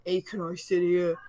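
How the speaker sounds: lightly mimicked man, slow drawn-out hey; slow, mock-casual approach to strangers; man's chat-up. Man asks two girls to sit, lightly mimicked chat-up.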